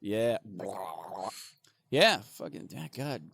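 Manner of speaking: usual speaking voice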